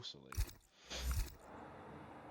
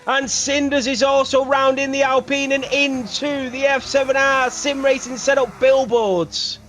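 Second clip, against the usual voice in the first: louder, excited play-by-play; race commentary; 21 dB louder than usual. Acted.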